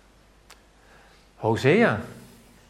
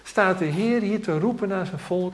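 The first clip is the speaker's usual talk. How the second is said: pleading; 7 semitones higher, slower than usual. Shown as a demonstration.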